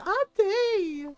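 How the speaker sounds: put-on high, bright kid-brother call of Auntie. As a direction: call out to your aunt from across the room. Brother calling out on video call.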